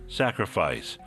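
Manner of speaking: normal talking voice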